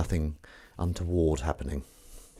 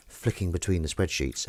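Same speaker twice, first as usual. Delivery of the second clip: ordinary talk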